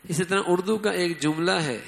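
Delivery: ordinary talk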